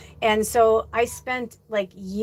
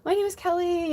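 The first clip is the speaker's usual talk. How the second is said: higher, sweet polite intro; super kind; 8 semitones higher, 3 dB quieter than usual. Retold or imitated. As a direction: put on your nicest voice. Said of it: "was super kind".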